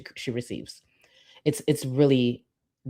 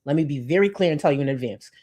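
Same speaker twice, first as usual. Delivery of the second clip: ordinary talk, nothing marked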